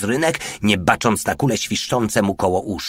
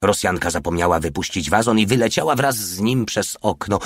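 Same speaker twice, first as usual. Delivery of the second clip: usual speaking voice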